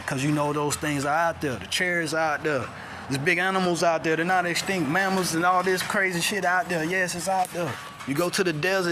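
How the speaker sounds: loud, high, fervent street preaching; preaching at full force. Acted. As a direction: build it up and hammer the warning home. Preaching builds to loud peak.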